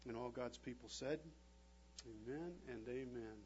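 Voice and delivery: soft, earnest prayer voice. Real, not acted. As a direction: pray quietly with bowed head. Pastor prays over congregation.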